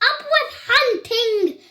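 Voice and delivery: high, quick rallying cry; shouted cheer. A narrator's reading. They cheer on the hunt, shouting. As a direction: call it out high, like a slogan. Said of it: "And they shouted".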